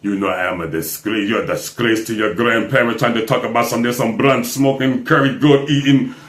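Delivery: very low, subdued voice of a disapproving dad; Kamala Harris' father. Retold or imitated. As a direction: drop deep and quiet for a grave scolding. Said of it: "the only comment that that man made was".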